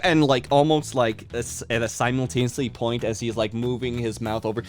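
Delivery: normal talking voice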